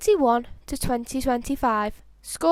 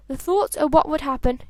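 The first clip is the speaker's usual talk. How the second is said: ordinary delivery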